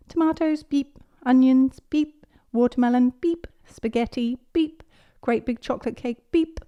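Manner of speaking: high, chirpy checkout voice; Miss Rabbit. A narrator's reading. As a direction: sing out each item and beep. Miss Rabbit scanning items.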